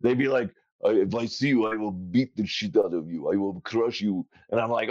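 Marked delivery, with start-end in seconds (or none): 0.8-4.3 lightly put-on Russian billionaire; mild mock threat in a Russian accent. Guest quoting Russians who heard the accent, light Russian. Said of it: "my rich Russian billionaire accent".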